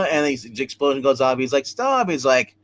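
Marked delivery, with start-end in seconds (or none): 1.6-2.1 high-pitched acted cry, falling away; mock desperate plea. Glowing man as the explosion goes off, desperate plea.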